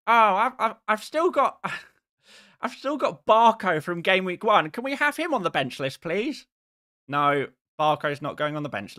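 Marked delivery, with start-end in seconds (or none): 0.1-6.5 high, whiny pestering viewer; mock the nagging chat, pitched up; a whiny viewer begging, pitched high; annoying chat voice. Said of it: "That is my impression of people who annoy me".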